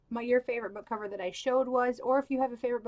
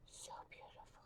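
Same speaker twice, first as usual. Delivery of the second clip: whisper; 26 dB quieter, faster than usual. Real, not acted. Admiring book cover aloud.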